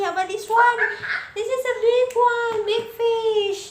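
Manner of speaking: very high, loud and slow, half sung; playful singsong. Acted. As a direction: make the big fish sound huge and fun. Showing picture to child.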